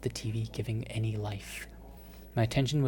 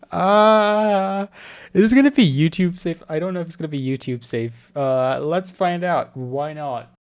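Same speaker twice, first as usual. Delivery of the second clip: flustered; 5 semitones higher, 9 dB louder than usual. Real, not acted. Worried about racy scene.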